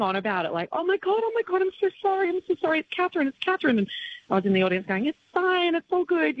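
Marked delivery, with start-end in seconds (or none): 0.7-3.8 much higher, flustered repeated sorry, imitated; frantic apology. Dolly apologizing for wrong name. 5.1-6.4 much higher, breezy brush-off, retold; reassuring. Reassuring Dolly from the audience.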